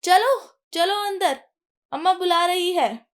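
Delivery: a panicked call to come inside; urgent shout. A narrator's reading. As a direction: yell at her to get inside now. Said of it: "तभी वीर चिल्लाता है".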